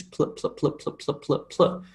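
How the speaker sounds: barely voiced PL, P straight into L; consonant cluster. Shown as a demonstration. Teacher drilling the sound pl, soft clipped cluster demo. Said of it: "p and l together".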